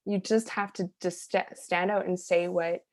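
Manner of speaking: ordinary delivery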